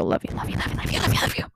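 rapid-fire repeated endearment; words tumbling out without a gap; gushing affection. Real, not acted. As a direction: smother them in quick love-yous. Showering listeners with affection.

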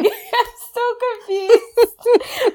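high, slow words cracking with laughter; helpless high laughter; laughing. Real, not acted. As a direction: squeal it out laughing. Laughing about bucket baths.

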